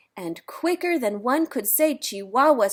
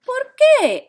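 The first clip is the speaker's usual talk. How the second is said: high squeaky question; 10 dB louder than usual. A narrator's reading. Skipito asks.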